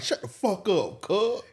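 hammed-up comeback to a heckler; mock snapped retort. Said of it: "He like".

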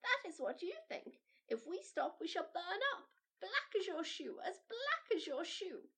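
high, anxious dancing kernel; a popcorn creature warning of burning; frightened popcorn doll. A narrator's reading. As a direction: Maisie Popcorn warns, afraid to stop. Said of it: "Maisie Popcorn carried on".

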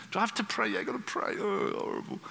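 high, reluctant grumble; mock dread. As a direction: groan about a tedious chore, hammed up. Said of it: "Prayer becomes a pain".

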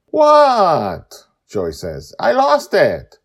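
high, loud, playful faux-innocent voice; mock innocence. Joy feigning innocence to the crew, high loud faux-innocent. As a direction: play dumb sweetly.

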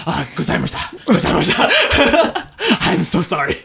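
laughing, hammed-up apology; mock Japanese apology. Host apologizing to anime characters, mock-solemn then laughing. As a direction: apologize grandly, breaking into laughter.

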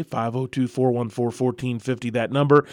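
usual speaking voice

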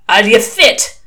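put-on loud demand in a roaming British accent; unplaceable British accent. A narrator's reading. Shadwell checking a recruit's fitness, unplaceable British accent. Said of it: "Mister Shadwell's accent was unplaceable".

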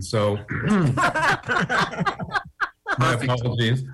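talking with a mouth full; words around a forkful of food. Real, not acted. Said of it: "I just put a fork in my mouth".

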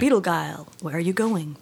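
dramatic spoken narration instead of singing, dropping to a whisper; half-whispered William Shatner delivery; Shatner-style narration. Retold or imitated. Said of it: "like, Shatner style, like, just everything was narrated".

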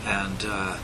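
ordinary talk

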